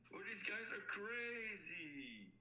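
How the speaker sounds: high, slow, stunned; amazed disbelief. Real, not acted. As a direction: drag it out in disbelief. Stunned by the price.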